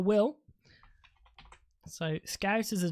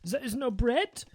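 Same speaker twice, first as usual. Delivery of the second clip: much higher, outraged fake German accent; mock German accent; 7 semitones higher than usual. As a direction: splutter like a furious German shopper. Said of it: "they were like".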